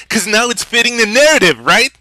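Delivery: usual speaking voice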